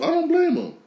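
sudden very high-pitched protest; high-pitched exclamation. Real, not acted. Host siding with a kicked player, high-pitched exclamation. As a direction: leap way up into a high squeal.

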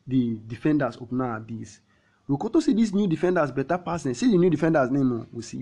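usual speaking voice

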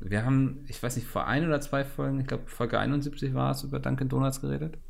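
ordinary talk

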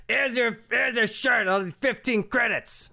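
a pushy alien shopkeeper haggling; Borkat voice. Acted. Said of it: "my Borkat voice".

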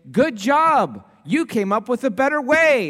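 the flattering voice you'd like to hear; mock cheery pat on the back; mock praise. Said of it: "That's the voice I would like you to speak".

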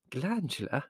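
mildly alarmed mother. Acted. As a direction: echo it as an alarmed, hushed question.

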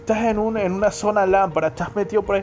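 "¿Qué tal, brother?" höher und lauter, wie ein aniñado aus Sambo; posh rich kid. Retold or imitated. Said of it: "Estos manes te hablan así".

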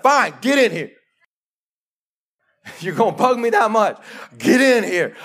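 high, loud, fed-up shout with a laugh; exasperated Jesus. Acted. Jesus giving in to the persistent knocker, shouting with a laugh. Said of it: "and finally said".

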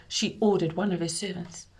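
plain reading voice

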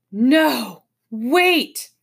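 halting call, caught by something; awestruck halting. A narrator's reading. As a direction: stop your brother with a stretched word. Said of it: "There was a quality in Tom's voice".

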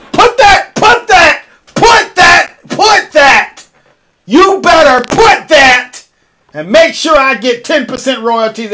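high, excited shouts of put that; repeated excited shouting, slowing on the last one; hyped shout. Real, not acted. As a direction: chant it up high like a hype man. Hyping his catchphrase, demanding royalties.

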